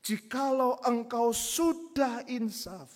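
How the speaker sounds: loud, high, slow solemn reading; God's solemn word. Retold or imitated. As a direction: declare the verse as God speaking. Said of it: "dikatakan di sini".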